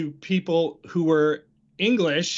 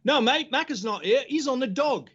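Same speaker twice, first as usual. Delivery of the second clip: high, breezy London phone voice; lightly imitated cockney accent, higher in pitch; cockney broker; 8 semitones higher than usual. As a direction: brush off the caller like a cockney mate. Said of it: "his buddy would answer and say".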